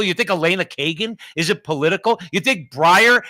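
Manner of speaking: usual speaking voice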